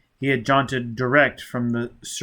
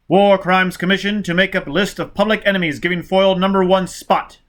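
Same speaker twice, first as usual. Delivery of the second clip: higher, louder bulletin read; official broadcast voice; 7 semitones higher, 6 dB louder than usual. Acted. Bulletin naming Foyle public enemy, high and loud. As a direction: announce the manhunt with urgency.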